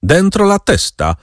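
high, barked order; a rider ordering the baron back inside; commanding yell. A narrator's reading. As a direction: snap the order Dentro la testa!, mock yelled. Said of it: "wrzasnął ktoś rozkazująco".

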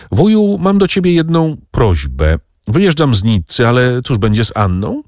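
put-on a young nephew's voice, lifted and hopeful; eager young nephew. A narrator's reading. Nephew Bogdan, shy but hopeful, asks his uncle a favour.

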